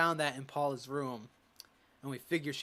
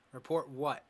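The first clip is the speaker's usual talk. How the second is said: ordinary delivery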